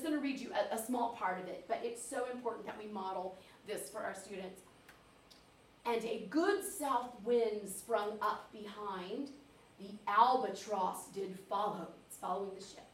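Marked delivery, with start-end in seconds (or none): 5.9-11.9 slow, measured poetry reading; poem recitation. Acted. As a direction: model expressive verse reading for a class. Reads poem aloud.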